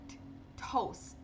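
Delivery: "T, toast" breathy, final t just stopped; unreleased final T. Shown as a demonstration. Teacher showing the last t of toast unreleased. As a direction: stop the air on the last t of "toast". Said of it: "Olha o último".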